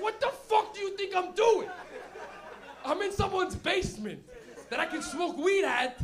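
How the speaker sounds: loud, high, drawn-out mock outburst; acted teenage outrage, stretched out; exasperated comeback. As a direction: yell back at a nagging mom, hammed up. Acted son snapping back at mother.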